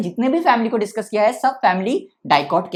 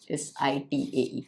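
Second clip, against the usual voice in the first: letters S I T A E read slowly and much softer; spelling out; 7 semitones lower, 11 dB quieter, much slower than usual. Shown as a demonstration. Teacher spelling the word ending for notes, slow and soft. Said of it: "spells the ending".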